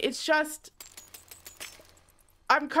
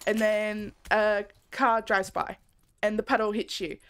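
ordinary delivery

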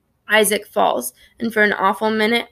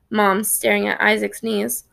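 ordinary delivery

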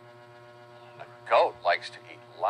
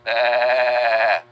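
loud, higher bleating bah; lamb bleat; 4 semitones higher, 10 dB louder than usual. Retold or imitated. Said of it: "And a lamb goes".

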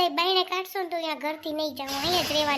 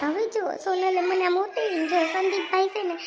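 tearful, words through sobs; wounded and weeping as she complains; wife crying to her husband about the insult. Acted. Wife cries after humiliation.

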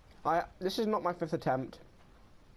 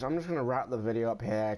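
ordinary delivery

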